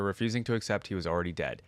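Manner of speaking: usual speaking voice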